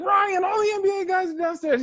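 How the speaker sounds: high, loud cry of disbelief; excited shout. Retold or imitated. Waking his roommate about the NBA players, excited shout.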